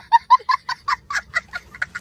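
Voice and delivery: high, loud staccato fake laugh; loud, very high-pitched put-on cackle; demonstrated laugh-or-cry. Shown as a demonstration. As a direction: squeal out a laugh that could be sobbing. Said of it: "थोड़ा हंस रहा हूँ कि रो रहा हूँ".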